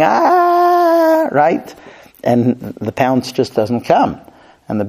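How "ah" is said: long, high "aah" held on one note; mock yell. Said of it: "and he's yelling".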